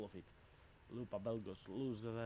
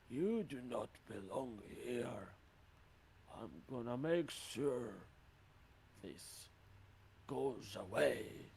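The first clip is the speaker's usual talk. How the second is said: slow, drawn-out creature voice; menacing mist creature; slower than usual. Acted. Mist creature warns an intruder who does not belong, slow and menacing. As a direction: draw each word out slowly as a threat. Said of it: "as it will say".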